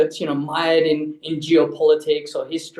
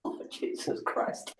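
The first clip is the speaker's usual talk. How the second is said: hushed, drawn-out exasperation; exasperated mutter; 14 dB quieter, slower than usual. Real, not acted. Guest outed as working at a bank, exasperated mutter. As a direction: mutter it under your breath, slowly. Teased about his employer being named.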